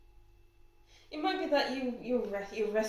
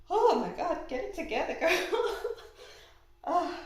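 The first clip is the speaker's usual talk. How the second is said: high, loud start breaking into laughter; teary laughing; 8 semitones higher, 4 dB louder, faster than usual. Real, not acted. Reader telling herself to pull it together, teary laughing. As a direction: scold yourself through a tearful laugh. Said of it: "I'm feeling all teary".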